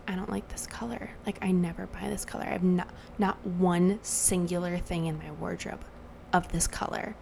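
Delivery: hushed imitation of a nail technician; very quiet. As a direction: keep the quoted complaint soft, half-whispered. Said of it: "the woman was so quiet".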